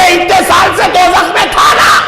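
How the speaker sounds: high, ringing question in a preacher's retelling; declaimed, high-pitched questioning; voiced challenge. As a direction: call out the challenge as a quoted speaker. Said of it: "بولے".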